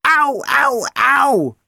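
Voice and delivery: a comic, squeaky wail of pain from the dish; moaning. Acted. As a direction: moan like a hurt tin dish. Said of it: "moaned the dish".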